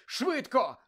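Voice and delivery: high, loud impatient command; shouting. A narrator's reading. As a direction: yell it to get something moving. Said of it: "закричав на нього Гаррі". (нетерплячий крик)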